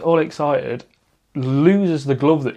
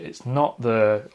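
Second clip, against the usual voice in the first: ordinary delivery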